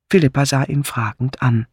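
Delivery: ordinary delivery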